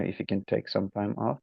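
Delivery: normal talking voice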